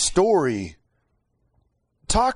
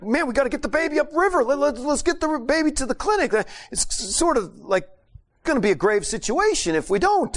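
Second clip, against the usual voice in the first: higher, fast urgent plea; urgent pleading; 10 semitones higher than usual. Retold or imitated. Ucias urging the mother to take the baby to the clinic, higher fast plea. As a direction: rush the words to push her to act.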